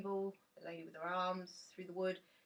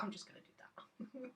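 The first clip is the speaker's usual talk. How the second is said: cheeky aside; 5 semitones higher, 6 dB quieter than usual. Pretend. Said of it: "you're like".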